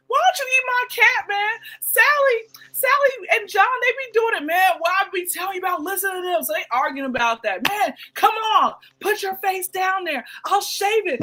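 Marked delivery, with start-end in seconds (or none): 0.1-6.4 high nagging wife voice; high whining girlfriend complaint; whiny wife. Acted. Wife voiced pleading husband. 7.7-11.2 pleading wife. Retold or imitated. Couple arguing voiced.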